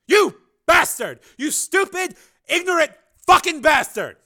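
very loud, high furious yell; screaming curse. Acted. Engineer screaming at the senator while hauled out. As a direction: scream it as you're dragged out. Higgins dragged out, cursing.

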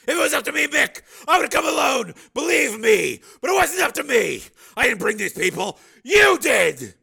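very high, loud appeal; pleading shout. A narrator's reading. Steel appealing to his old partner, shouting. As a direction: plead across the distance.

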